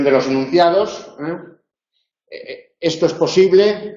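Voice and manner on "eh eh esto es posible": rising question tune on posible. Shown as a demonstration. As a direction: ask it, ending on a rise on posible. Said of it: "para distinguir entre la pregunta".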